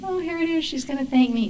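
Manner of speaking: high, hushed anticipation; expectant thought. Retold or imitated. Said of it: "and I'm thinking".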